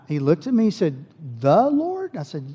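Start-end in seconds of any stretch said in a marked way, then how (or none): 1.4-2.2 a much higher, startled "The Lord?"; father caught off guard; surprised question. Retold or imitated. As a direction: pitch up in disbelief. Dad surprised by son's claim.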